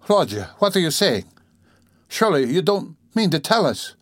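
high-pitched, mildly alarmed questioning. A narrator's reading. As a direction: question him with rising alarm. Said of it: "began in alarm".

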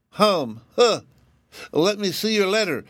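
high, hesitant hum then demand; doubtful rabbit. A narrator's reading. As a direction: still suspicious, high rabbit voice. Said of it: "as if he still doubted her".

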